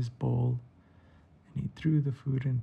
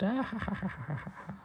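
high burst of laughter tapering off softer; staged laugh that falls away; acted gecko laugh; 8 semitones higher, 3 dB quieter, faster than usual. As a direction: play a gloating cartoon laugh. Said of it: "And Dale laughed".

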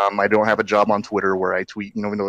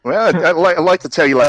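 put-on Aussie accent, pitched up; Australian accent; 8 semitones higher, 6 dB louder than usual. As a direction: lay on a thick Australian accent. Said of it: "that horrible Australian impression".

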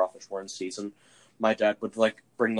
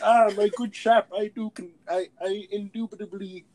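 mock posh British; 10 semitones higher, 5 dB louder than usual. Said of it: "They're like".

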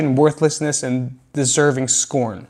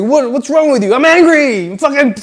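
high, loud mock rage; angry outburst; 11 semitones higher, 6 dB louder than usual. Acting out throwing anger at people, high furious yell. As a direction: blow up, pitch leaping way up. Acts out hurling an anger fireball.

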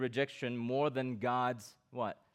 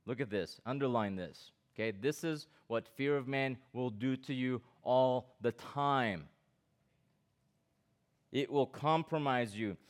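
ordinary talk, nothing marked

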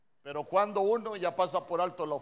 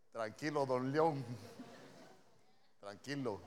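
soft, low comic pacifying; calm, soothing reply; 4 semitones lower, 4 dB quieter than usual. Acted. Preacher models a wife calming her lion of a husband, calm and soothing. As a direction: talk an angry partner down gently. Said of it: "esa tonalidad alta, ¿qué le va a decir?".

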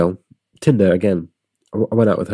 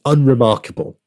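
louder, higher "Unremarkable"; mock indignant; 6 semitones higher, 5 dB louder than usual. Man defending his size to laughing girlfriend, mock indignant. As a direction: protest a bit too hard.

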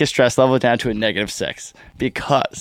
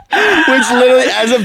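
laughing; 11 semitones higher, 4 dB louder than usual. Real, not acted. Laughing mid-story.

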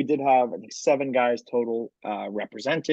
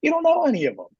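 loud, high-pitched comic rant; Bill Burr; 5 dB louder than usual. Retold or imitated. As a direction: shout it incredulously, pitched way up, like a stand-up bit. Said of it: "butchered a Bill Burr joke".

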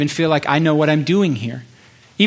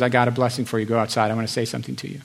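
low, much softer, intimate voice of the Lord; hushed voice of God; 5 semitones lower, 7 dB quieter, faster than usual. Acted. The Lord calling the listener aside, low and hushed. God's voice in the tent.

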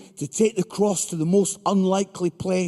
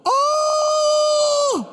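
long high shocked "Oh!"; shocked high cry. Acted. Shocked exclamation at son's reply.

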